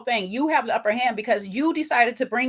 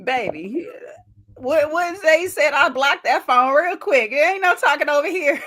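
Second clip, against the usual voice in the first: laugh-crying; 4 semitones higher, 3 dB louder than usual. Real, not acted.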